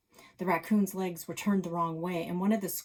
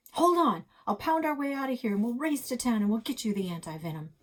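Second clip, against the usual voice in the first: higher, louder and faster, frantic; 6 semitones higher, 6 dB louder, faster than usual. Acted. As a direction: rush the promise to help. Chase frantic reassurance.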